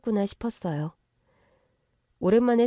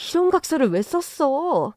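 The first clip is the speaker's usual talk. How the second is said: higher-pitched outraged mother; angry scolding; 7 semitones higher, 5 dB louder than usual. Acted. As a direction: demand an explanation, upset. Mother confronts daughter over signed pledge.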